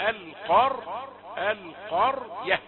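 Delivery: القرية said very slowly, ra held heavy before the ya; settle the heavy r first. Shown as a demonstration. Teacher showing the right way to read القرية. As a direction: lean on the thick r in القرية, then add the ya. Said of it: "استقر على تفخيم الراء أولا".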